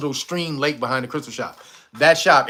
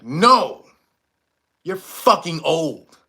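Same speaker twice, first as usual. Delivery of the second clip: blunt lady; much slower than usual. Retold or imitated. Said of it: "She said it like that".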